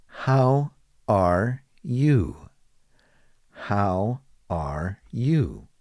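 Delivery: drawn out, each word separate; slow, clear teacher demo of the question. Shown as a demonstration. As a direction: model it slowly for learners. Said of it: "they might simply just ask".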